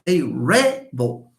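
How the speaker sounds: slow "rebel" as REB-ul; stress on first syllable. Shown as a demonstration. Teacher models the noun for students, "rebel" stressed up front. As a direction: stretch out "rebel" with the weight on "reb". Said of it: "because it's a noun".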